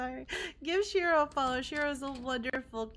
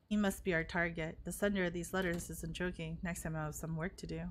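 lower, grim quiet narration; low serious voice; 6 semitones lower, 4 dB quieter than usual. Acted. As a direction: read the threat low and serious. Voices a game character.

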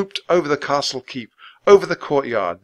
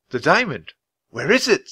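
surprised, higher question; alarmed question; 5 semitones higher than usual. Acted. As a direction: ask in alarm. Jack alarmed.

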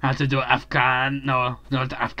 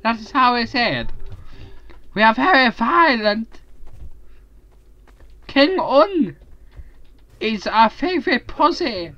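hammed-up mock Chinese accent; 8 semitones higher than usual. Player doing a voice for friends, hammed-up mock Chinese accent. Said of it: "how many voices can you do".

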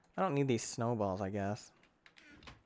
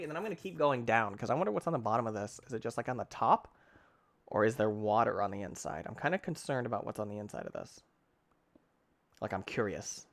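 ordinary talk

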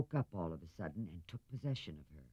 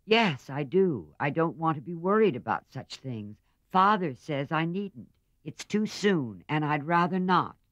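petulant; 6 semitones higher, 6 dB louder than usual. A narrator's reading. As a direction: toss it off like a spoiled girl. Meg refusing, petulant.

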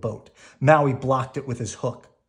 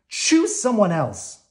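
sobbing yell; 8 semitones higher, 6 dB louder, slower than usual. A narrator's reading.

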